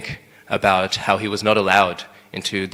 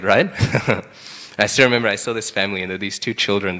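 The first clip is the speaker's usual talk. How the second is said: normal talking voice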